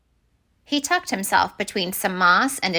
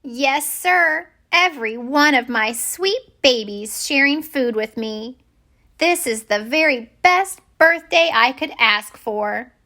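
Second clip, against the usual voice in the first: slow, higher, grandmotherly voice; doting grandmother fish; 5 semitones higher than usual. Acted. Big Betty greeting her children and grandchildren, slow doting voice. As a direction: savor every word like a proud granny.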